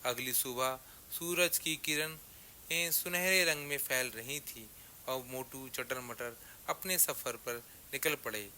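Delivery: usual speaking voice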